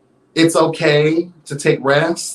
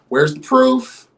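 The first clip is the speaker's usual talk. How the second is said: exasperated demand; 10 semitones higher, slower than usual. Real, not acted.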